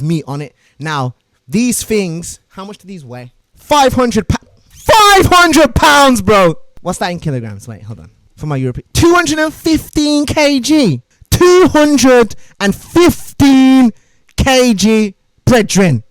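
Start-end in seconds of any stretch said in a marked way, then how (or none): 3.5-6.5 high, loud, very slow "five hundred pounds"; stretched emphatic figure; emphatic weight. Real, not acted. Stressing the heavy weight. 8.9-15.1 high, loud, very slow "two hundred and fifteen"; stretched announcer-style figure; dramatic announcement. Real, not acted.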